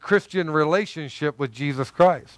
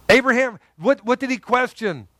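ordinary talk, nothing marked

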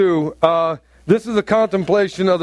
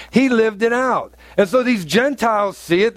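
ordinary talk

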